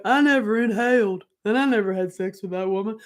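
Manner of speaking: lightly voiced denial; a hint of a politician denying it; Bill Clinton. Imitating Bill Clinton denying, lightly. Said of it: "remember Bill Clinton?".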